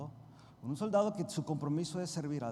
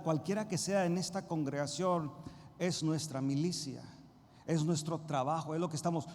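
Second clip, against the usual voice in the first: ordinary talk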